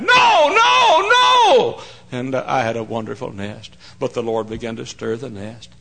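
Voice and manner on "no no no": very high, drawn-out loud cries; shouted protest. Retold or imitated. Congregation protests the pastor resigning, shouted protest. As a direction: wail it out like a protesting crowd. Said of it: "several folks shouted".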